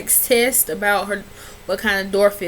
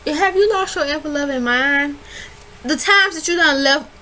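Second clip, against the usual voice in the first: exasperated scolding; 6 semitones higher than usual. Real, not acted. Scolding Chris in recap.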